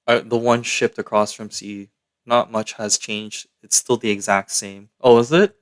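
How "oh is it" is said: ordinary talk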